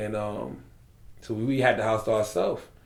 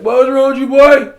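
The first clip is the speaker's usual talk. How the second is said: put-on high, loud country-boy holler; 11 semitones higher, 12 dB louder than usual. Friend hollering about him in country talk, loud and high. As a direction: belt it out like a South Carolina country boy. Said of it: "A lot of our people talk like that".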